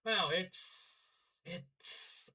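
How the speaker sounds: soft, halting amazement; stuttered awe. Acted. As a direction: stumble quietly over the words. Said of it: "Platinum stuttered".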